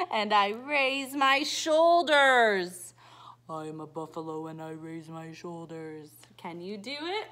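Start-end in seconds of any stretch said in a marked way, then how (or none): 0.1-2.9 loud. Acted. Reading buffalo page. 3.5-6.1 rumbling storybook buffalo; low buffalo voice. Acted. Rereading buffalo page.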